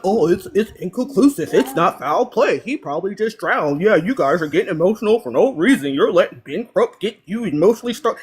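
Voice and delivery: mock the smug commenter who waves it off; a hint of a condescending online voice; mocking quote. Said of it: "gassing up".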